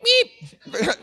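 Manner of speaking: high, feeble horn "meep"; imitated weak little beep; tiny car horn beep. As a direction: squeak out a puny car horn. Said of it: "what would it do?".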